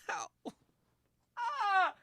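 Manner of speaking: laughing ow then sharp cry; pained ow and yelp. Real, not acted. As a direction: yelp of pain after falling. Pain after the fall.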